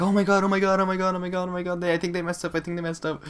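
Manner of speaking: breathless giddy panic; excited freakout. Retold or imitated. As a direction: squeal it out like you can't believe your luck. Retelling his own freakout leaving store.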